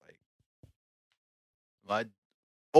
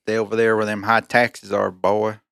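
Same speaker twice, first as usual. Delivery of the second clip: put-on cowboy accent; 4 dB louder than usual. Sending Californians back, mock cowboy accent. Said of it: "a cowboy accent".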